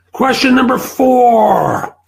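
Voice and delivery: high, stretched-out heading, last word held then falling; announcer call. Real, not acted. Host announcing the next question to viewers, called out loudly. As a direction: call out the heading with a long ringing finish.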